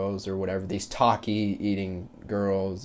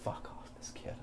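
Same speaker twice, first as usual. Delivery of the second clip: annoyed whispered curse under the breath; whisper to himself; 5 semitones higher, 18 dB quieter than usual. Retold or imitated. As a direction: breathe it out, irritated, so only he hears. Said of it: "I just tell myself in the back of the head".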